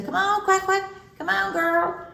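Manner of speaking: high, singsong call; calling a pet. Retold or imitated. Keeper calling her duck in from the field, calling out.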